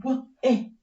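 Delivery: normal talking voice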